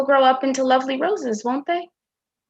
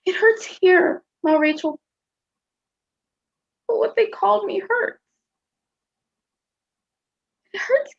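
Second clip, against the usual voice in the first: put-on tearful hurt child; 7 semitones higher than usual. Hurt child asks about insult.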